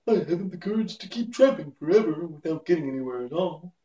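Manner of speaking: high-pitched whimpering cowardly lion. A narrator's reading. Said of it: "said the Cowardly Lion with a whimper".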